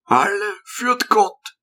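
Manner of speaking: put-on meek old monk, slow and soft; old hermit. A narrator's reading. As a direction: let the hermit say it slowly and gently.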